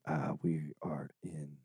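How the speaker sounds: low, soft radio host voice. Real, not acted. Host announcing where they are performing, soft radio voice. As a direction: drop into a hushed radio announcer's register. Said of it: "your radio voice".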